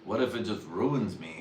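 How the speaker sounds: ordinary delivery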